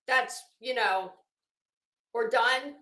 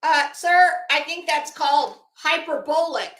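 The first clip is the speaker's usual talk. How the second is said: sarcastic correction; 4 semitones higher, 8 dB louder than usual. Real, not acted. Mocking the author's word use.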